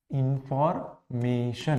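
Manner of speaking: Information slowly in two chunks, इन्फॉर, then mason; divided word. Shown as a demonstration. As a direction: split information before मे. Breaks word up for syllable count.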